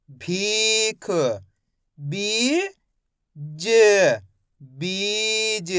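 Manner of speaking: each syllable held long, then blended, as in पीर and ढीली; very slow reading drill with long ee in भीख, बीज; direct syllable sounding. Shown as a demonstration. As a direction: stretch each sound with pauses between. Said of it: "direct मात्रा का उच्चारण करके".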